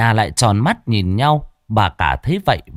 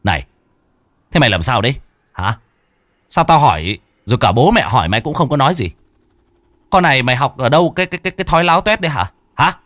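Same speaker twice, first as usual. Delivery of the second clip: fast, louder angry scolding; scolding shout; 4 semitones higher, 3 dB louder, much faster than usual. A narrator's reading. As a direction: snap at the wife, pointing. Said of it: "anh chỉ tay mà quát". (quát mắng nhanh, to tiếng)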